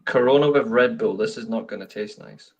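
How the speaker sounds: ordinary talk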